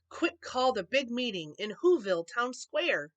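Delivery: usual speaking voice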